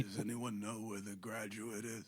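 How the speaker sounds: put-on frail old man voice. Frail Dustin Hoffman impression.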